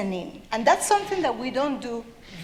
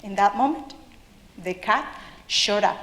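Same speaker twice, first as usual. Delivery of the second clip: usual speaking voice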